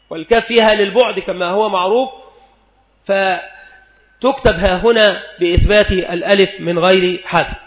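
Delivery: normal talking voice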